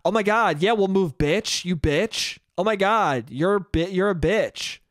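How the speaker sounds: ordinary talk, nothing marked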